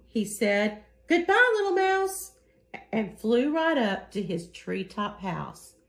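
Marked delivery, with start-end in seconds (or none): 1.1-2.3 very high, strained goodbye of a scared owl; frightened owl. Acted. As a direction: squeal it out while fleeing.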